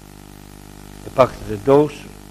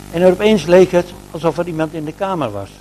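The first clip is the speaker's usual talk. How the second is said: usual speaking voice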